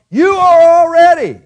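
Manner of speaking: sudden loud high shout. Real, not acted. Preacher shouting at the wandering congregation, loud high cry. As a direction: blast the word already at them.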